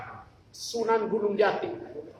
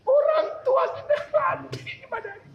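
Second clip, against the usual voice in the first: laughing; 3 dB louder than usual. Real, not acted. Laughing while telling sad story.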